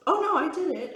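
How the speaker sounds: a startled oh no as it dawns on her; sudden realization. Real, not acted. Catching herself mid-thought, surprised oh no.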